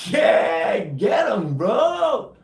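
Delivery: high, loud pumped-up yelling; re-enacted battle cheer. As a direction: cheer on the gunships at full voice. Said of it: "reprimanded for yelling all the time".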